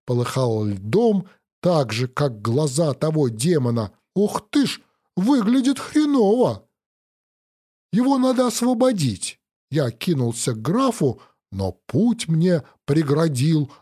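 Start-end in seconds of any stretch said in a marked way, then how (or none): 4.2-6.7 shocked. Acted. Hero shocked at the sight. 7.9-9.4 urgent. Acted. Hero urging to free the count.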